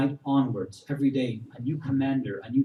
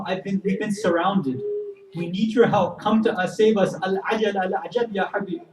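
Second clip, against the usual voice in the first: raised, mildly pleading appeal; urgent plea; 6 semitones higher than usual. Acted. Besieged leader writes to his old friend for help, urgent plea. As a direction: call for help with a touch of urgency.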